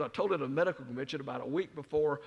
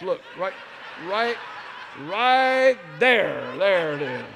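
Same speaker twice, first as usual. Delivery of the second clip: rising, louder and louder insistence, much higher; insistent calling out; 6 semitones higher, 13 dB louder, much slower than usual. Retold or imitated. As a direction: point at it and push harder each time. Showing son-in-law the wedding video.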